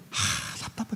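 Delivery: sighing under the breath, fed up; frustrated sigh. Acted. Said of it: "표정에서부터 답답해".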